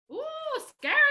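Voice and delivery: high squeal of pretend fright; playful fake fear; mock scared. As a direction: act spooked by the scary teeth. Mock fright at kids' monster teeth.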